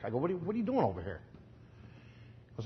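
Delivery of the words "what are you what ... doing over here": quick, soft Chicago street talk; Chicago slang. Retold or imitated. Preacher questions man at hot dog stand, Chicago slang. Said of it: "in my classic Chicago slang".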